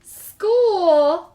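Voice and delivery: horrified realization; dismayed. A narrator's reading. Cubs realizing school is coming, dismayed. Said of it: "thought the Cubs".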